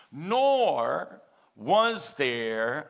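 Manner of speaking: each word drawn out, very slow; read slowly. Shown as a demonstration. Pastor reading scripture to the congregation, very slow. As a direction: let every word sink in slowly. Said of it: "I'm gonna read it slowly".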